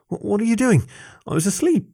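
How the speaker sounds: high-pitched, stammering bewilderment; confused. A narrator's reading. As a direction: stumble into the question, pitched well up.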